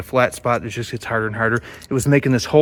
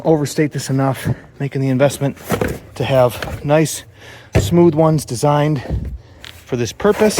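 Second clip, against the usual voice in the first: usual speaking voice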